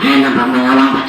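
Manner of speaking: low, fast thug voice; gruff thug. Acted. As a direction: bark it like a street tough. Kidnapper interrogates child.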